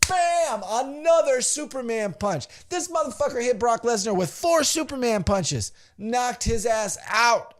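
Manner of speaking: high-pitched, thrilled retelling; excited play-by-play. Retold or imitated. Fan retelling four Superman punches, excited and high. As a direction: build the hype of the knockout blows.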